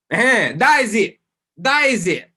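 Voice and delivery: excited victory call to viewers. Real, not acted. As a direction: savour the win out loud. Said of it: "getting excited".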